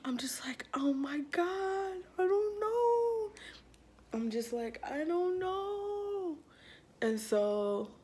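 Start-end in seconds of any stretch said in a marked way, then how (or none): 0.7-3.3 high, drawn-out whine; mock distress. Girl reacting to her hard stomach, mock distress. Acting out her panicked self. 4.8-6.4 very slow, high whine; mock helpless wail. Girl unsure if she is pregnant, mock helpless wail. Repeating her helpless reply.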